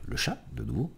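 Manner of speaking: usual speaking voice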